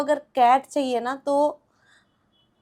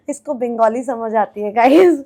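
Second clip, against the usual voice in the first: ordinary delivery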